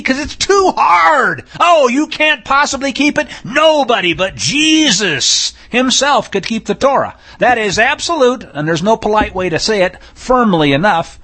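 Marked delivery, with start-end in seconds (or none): 0.0-7.1 mocking objection. Preacher parodying those who say Torah is too hard, mocking. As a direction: parody their complaint, pitched well up.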